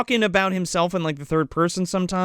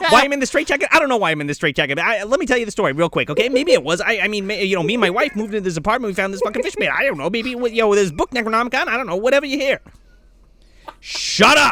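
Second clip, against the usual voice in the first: frantic straitjacket narrator; 5 semitones higher, faster than usual. Retold or imitated. Mock rambling straitjacket narrator.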